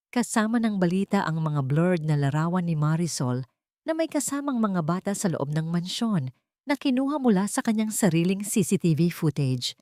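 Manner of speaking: usual speaking voice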